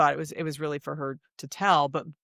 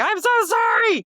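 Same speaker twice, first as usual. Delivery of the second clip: high, loud cry of sorry; sudden high apologetic exclamation; emphatic apology; 8 dB louder than usual. Retold or imitated. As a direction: burst out with a big apology. Heartfelt outburst in interview.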